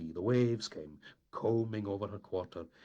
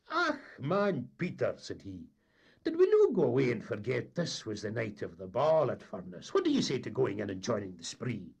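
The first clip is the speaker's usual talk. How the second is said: raised, lively Highland mate's voice; Dougie coaxing; 5 semitones higher, 8 dB louder than usual. A narrator's reading. Mate coaxing captain to put into Furness, lively and eager. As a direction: wheedle the captain into port.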